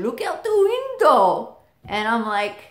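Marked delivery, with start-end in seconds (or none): 0.0-1.6 excited dad. Retold or imitated. Dad excitedly telling brother about horse.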